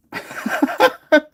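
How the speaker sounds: high, loud burst of laughter; sudden loud cackle. Real, not acted. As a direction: laugh out loud without holding back. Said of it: "That was a little loud".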